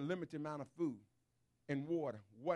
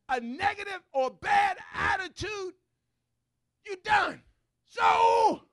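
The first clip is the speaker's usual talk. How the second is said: loud, higher sermon voice; full-force preaching; 10 semitones higher, 12 dB louder than usual. Real, not acted. Preacher shouting to the church about bad attitudes. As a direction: hammer it home to the pews.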